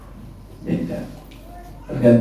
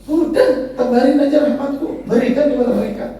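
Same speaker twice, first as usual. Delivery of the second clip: much higher, brisk divine command; voicing God; 13 semitones higher than usual. Preacher voicing God telling angels to spread mercy, high and brisk. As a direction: hand out mercy freely, high and quick.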